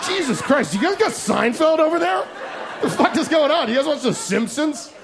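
high, laughing disbelief, hammed up; mock amazement. To the Israeli guys, mock amazed and laughing. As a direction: gush like you just discovered comedy.

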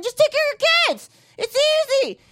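high, fast mocking lecture voice; preachy scolding voice. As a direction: sneer it like a smug know-it-all. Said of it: "They're just like".

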